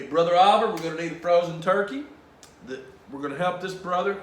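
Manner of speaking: lightly voiced priest calling out to a helper; loud, brisk call to a helper; Father Tim. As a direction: call out the name, then give orders. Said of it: "He looked up at me".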